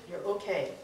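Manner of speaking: usual speaking voice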